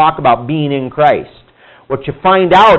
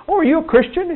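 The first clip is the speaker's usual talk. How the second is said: bright, much higher pitched curious asking; surprised question; 13 semitones higher, 4 dB quieter than usual. Retold or imitated. Coworker sees the Bibles and asks the guard, high and surprised. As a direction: play the eager coworker spotting the Bibles.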